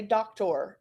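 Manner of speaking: "doctor" as DOK-tor, ending like "tore"; full, unreduced "or" closing "doctor"; unusual "or" ending. Shown as a demonstration. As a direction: say "doctor" with a full OR in the second syllable. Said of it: "we don't hear many people say".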